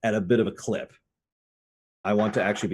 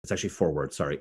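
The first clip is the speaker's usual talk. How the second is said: usual speaking voice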